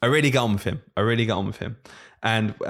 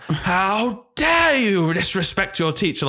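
indignant teacher; 7 semitones higher, much slower than usual. Retold or imitated. Old-school teacher scolding a child for using a first name, mock outrage. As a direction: drag out each word like a scandalised old-school teacher. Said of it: "this teacher just lost it".

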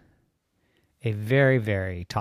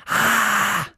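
a voiceless strained shout; hoarse failed yell; 12 semitones higher, 9 dB louder than usual. Acted. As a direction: try to yell but the voice cracks. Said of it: "I have a really loud voice. Listen".

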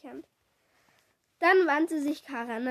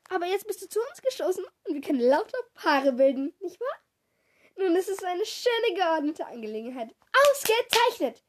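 lauter, höher, überdreht; Misses P überschwänglich; 5 semitones higher, 5 dB louder than usual. A narrator's reading. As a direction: ohne Luft zu holen drauflosreden. (Theaterpädagogin redet begeistert auf Nadima ein)

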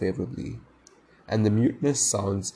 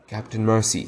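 ordinary delivery, nothing marked

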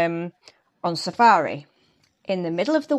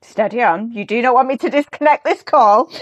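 louder, higher teasing warning; mock threat; 4 semitones higher, 7 dB louder than usual. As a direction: playfully warn the host off the topic. Jokingly warns host over football loss.

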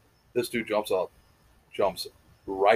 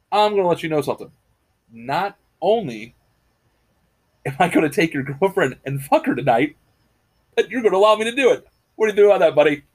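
cocky bar bully, rising louder and higher; taunting tough guy; 5 semitones higher, 4 dB louder than usual. Retold or imitated. A tough guy taunting a man at the bar, cocky and rising. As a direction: puff up and taunt him, buddy.

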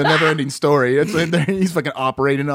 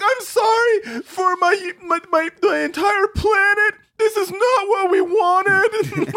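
much higher, slow, crying shout; mock sobbing wail; much slower than usual. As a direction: ham up a distraught woman sobbing. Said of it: "And she's like".

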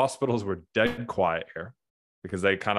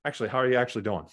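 fast follow-up; 3 semitones higher, much faster than usual. Retold or imitated. Pressing the real question.

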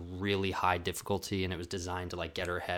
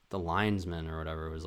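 usual speaking voice

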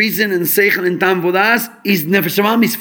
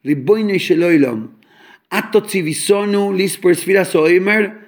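normal talking voice

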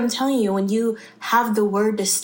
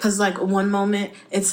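ordinary talk

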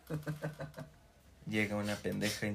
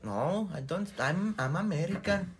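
higher, slow, prissy English; mock Mexican-American; 8 semitones higher, 4 dB louder, slower than usual. Mexican-American tourist brushing off Spanish, mock high slow English. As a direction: play the snooty tourist who denies Spanish.

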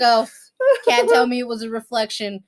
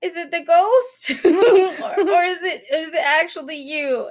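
playful spooked questioning, pitched up; mock wariness; 6 semitones higher than usual. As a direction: ask warily, half-laughing. Retold wary question to unseen child.

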